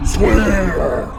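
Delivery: loud, high resounding shout; ghostly echo. Acted. Ghost echoing Hamlet's demand for an oath, shouting. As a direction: wail it like a phantom. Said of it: "the ghost echoed".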